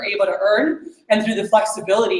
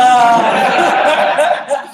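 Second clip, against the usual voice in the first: a louder burst of real laughter; amused laugh; 4 semitones higher, 5 dB louder than usual. Real, not acted. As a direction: laugh at your own luck. Laughs at the nice circle.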